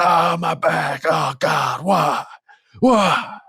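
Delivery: high hammed-up agony rising to a cry; pained wail. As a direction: wail why like your back is broken. Lying hurt on the ground.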